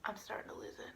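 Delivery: hushed, nearly whispered aside; barely-there whisper of playful despair; mock-weary confession. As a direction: confess it very softly.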